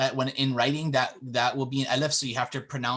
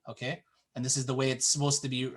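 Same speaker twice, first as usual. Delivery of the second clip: ordinary delivery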